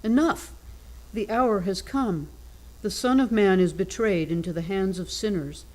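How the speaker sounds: usual speaking voice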